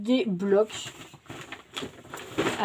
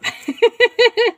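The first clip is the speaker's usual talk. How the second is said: quick, high giggle; loud burst of high-pitched giggling; laugh; 13 semitones higher, 8 dB louder than usual. Real, not acted. Vlogger nearly got the price wrong, giggling.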